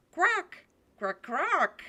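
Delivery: loud, high squawking; duck quack. Acted. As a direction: quack urgently like a drake. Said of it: "why is my dog voice so loud and grating?".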